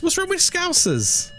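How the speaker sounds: high, slow, indignant; mock offended. Streamer mock-defending Scousers, high and slow. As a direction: ask it like you're personally offended.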